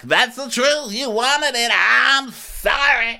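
high, loud mock impression with a drawn-out "sorry"; hammed-up high voice, stretching the last words; mock housewife. Recapping what she said on the show, high hammed-up mock voice. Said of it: "So she's like".

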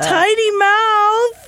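very high, drawn-out teasing squeal; silly high-pitched exclamation. Pretend. Teasing squeal at co-host's demonstration.

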